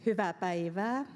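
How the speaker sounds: Hyvää päivää said in Finnish, stress on hyvää; Hyvää päivää, Finnish vowels, first syllables stressed; Finnish greeting. Shown as a demonstration. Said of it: "in Finnish".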